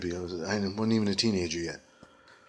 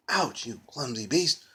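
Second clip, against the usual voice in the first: witch screech; 5 semitones higher than usual. Acted. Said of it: "a cracked voice screeched".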